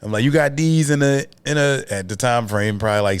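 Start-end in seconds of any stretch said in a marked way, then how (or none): 0.2-1.8 playing it off. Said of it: "I tried to play it off".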